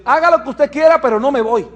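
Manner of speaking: high, loud, dug-in no; loud stubborn refusal. Retold or imitated. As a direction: plant your feet and yell it. Paciente se niega a irse. (negativa terca, alta y fuerte)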